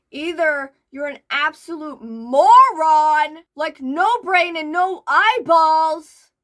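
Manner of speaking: loud, slow, drawn-out insult; exasperated insult. Real, not acted. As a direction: lean hard into every word. Insulting the poster.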